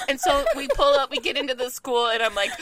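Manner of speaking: normal talking voice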